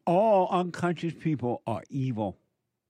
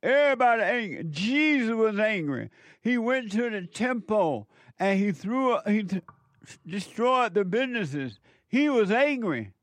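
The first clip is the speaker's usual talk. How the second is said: mock preacher; 7 semitones higher than usual. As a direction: mimic a fired-up preacher shouting from the pulpit. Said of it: "the preacher tell you".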